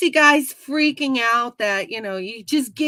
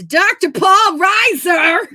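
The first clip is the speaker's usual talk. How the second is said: shouts the name, higher and louder; excited shout; 9 semitones higher, 10 dB louder, slower than usual. Real, not acted. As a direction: blurt out the big news. Excited news about returning doctor.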